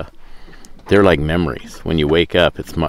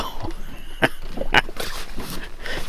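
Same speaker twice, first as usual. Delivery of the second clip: long breathy chuckle; amused, voiceless laughter; laughing; 6 dB quieter than usual. Real, not acted. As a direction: laugh at your own teasing. Laughs about her reaction.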